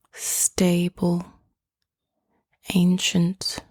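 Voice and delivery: ordinary delivery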